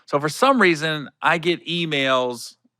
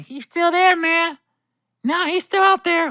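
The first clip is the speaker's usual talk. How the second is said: high-pitched voicing of the road manager; road manager's report. Road manager's repeated report, voiced.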